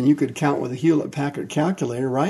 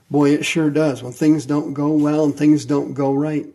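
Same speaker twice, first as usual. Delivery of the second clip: normal talking voice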